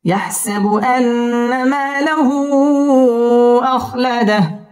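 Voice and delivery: slow, chanted tajweed recitation, high and drawn out; Quran recitation. Shown as a demonstration. Teacher reciting Surah Humazah for students, slow chanted tajweed. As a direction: intone each verse slowly with long held vowels.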